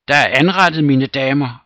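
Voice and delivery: loud, high call over the room; announcing dinner. Acted. Steward's wife calling the ladies to dinner, loud. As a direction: break in and summon everyone. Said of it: "afbrød hovmesterens kone ham".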